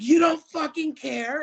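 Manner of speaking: high, loud, slowed mock outrage; retold indignation at the official; mock outraged. Challenging the official, high and loud mock outrage. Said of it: "And I was like".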